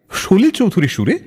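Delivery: usual speaking voice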